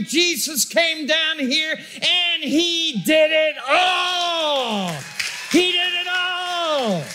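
high, ringing shouts, slowing on "He did it all"; preaching at full force. Acted. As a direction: hammer each "You didn't" home, then stretch out the last words. Preaching climax on salvation.

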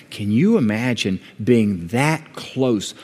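usual speaking voice

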